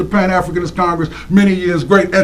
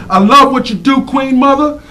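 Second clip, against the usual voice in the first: usual speaking voice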